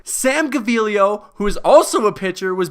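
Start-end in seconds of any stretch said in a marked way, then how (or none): none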